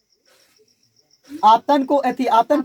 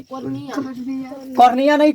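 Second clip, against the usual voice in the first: Cornea नहीं stretched out very slowly; teasing slow repeat for the class; slow drawn-out repeat; much slower than usual. Shown as a demonstration. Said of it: "cornea नहीं repeated".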